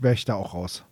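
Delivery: ordinary talk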